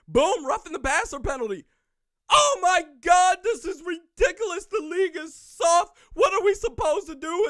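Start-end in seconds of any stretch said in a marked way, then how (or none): 2.3-7.5 shrill mock outrage, higher and louder; mocking fans. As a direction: whine like an outraged fan. Mocking whining Packers fans.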